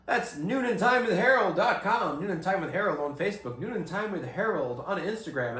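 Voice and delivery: big podcast intro; loud, high show-host opener; announcer voice. Acted.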